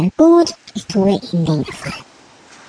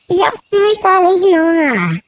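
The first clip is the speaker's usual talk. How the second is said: whiny hunger; 7 semitones higher than usual. Acted. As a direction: whine it like a starving puppy. Hungry dog complaining.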